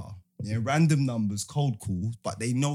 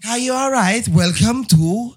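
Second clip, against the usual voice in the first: higher, louder mock hotel welcome; hotel greeting; 7 semitones higher, 8 dB louder than usual. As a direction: play the cheery hotel clerk. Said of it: "when you go to the hotel".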